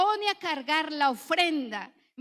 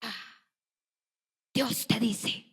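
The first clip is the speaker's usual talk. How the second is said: a cocky, self-important know-it-all; mock bold claim; 5 semitones lower, slower than usual. Preacher mocking a bold churchgoer, mock swagger. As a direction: puff up and pronounce it as God's word. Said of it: "se envalentona y dice".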